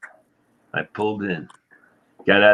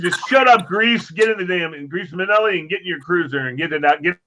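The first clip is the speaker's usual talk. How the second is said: annoyed sergeant; 6 semitones higher than usual. Retold or imitated. Sergeant snapping at Grease.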